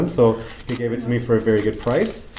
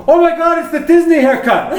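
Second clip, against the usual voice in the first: high, loud delighted shout across the salon; excited yell; 7 dB louder than usual. Retold or imitated. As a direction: shout it out with pure joy. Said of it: "she yells out".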